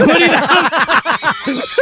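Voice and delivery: squeaky high mock sheik line, through laughter; laughing high sheik voice. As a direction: say it high and slow, cracking up. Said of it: "and he goes".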